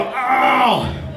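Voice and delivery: high, loud mock yelp; comic yelp of pain. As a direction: yelp it like a sudden pinch. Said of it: "and they go".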